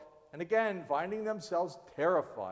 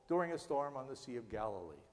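ordinary delivery

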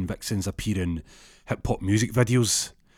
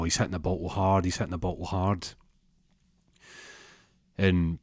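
ordinary delivery

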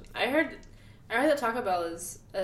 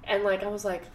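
ordinary talk

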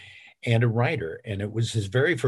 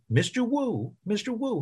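Mister Wu pitched way up high; higher voice; 12 semitones higher than usual. Shown as a demonstration. Narrator showing Wu's voice, pitched high. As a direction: lift the voice high for Wu. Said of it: "who talks up here and has a higher voice".

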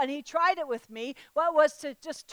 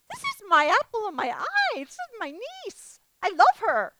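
hurt disbelief; 7 semitones higher than usual. Retold or imitated.

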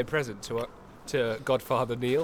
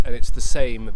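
sudden loud; 16 dB louder than usual. Real, not acted. Emphatic joke about tea.

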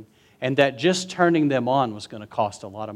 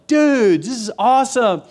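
high stoked surfer shout; excited yell; 8 semitones higher, 4 dB louder than usual. Retold or imitated. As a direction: shout it, thrilled. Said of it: "he's yelling".

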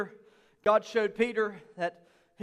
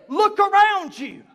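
slow, louder call to look; God's command; 7 semitones higher, 7 dB louder, slower than usual. Retold or imitated. Preacher voicing God telling the church to look, slow and loud. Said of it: "That is why God said".

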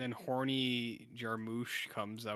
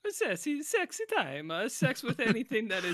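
put-on high, hammy Italian accent; mock Italian accent; 12 semitones higher, 3 dB louder than usual. Friend joining the bit, mock Italian accent. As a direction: play the randy Italian for laughs. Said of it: "I'm Italian".

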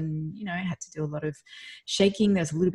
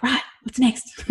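eager relief; 7 semitones higher, 7 dB louder, slower than usual. Retold or imitated. Relief after finishing the talk.

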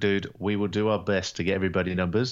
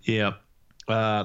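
ordinary delivery

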